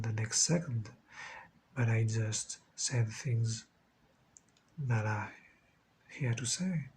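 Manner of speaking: low, hushed, slow channeling voice with long pauses; eyes-closed channeling, quiet and halting; trance-like channeling. Shown as a demonstration. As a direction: speak slowly and softly, letting each phrase arrive unplanned.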